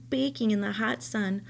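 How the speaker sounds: plain reading voice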